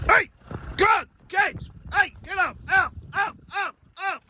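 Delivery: high sharp herding calls; calling out. Real, not acted. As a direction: drive the herd on, shouting. Driving cattle up the road.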